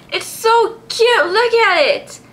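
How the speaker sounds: cooing. Real, not acted. Cooing over a cute Pokemon.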